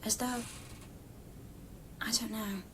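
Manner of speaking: whisper. Acted.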